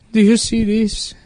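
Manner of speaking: put-on high, slow Mexican-accented voice; imitated man on the verge of tears; tearing up. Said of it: "And he's, like, tearing up".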